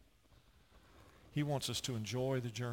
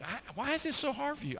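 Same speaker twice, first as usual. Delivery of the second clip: mock father, much higher; exasperated father, pitched way up; baffled dad. Dad voiced in story.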